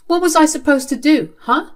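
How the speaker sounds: high, loud, challenging question; exasperated protest. Acted. Child to father after being blamed, exasperated.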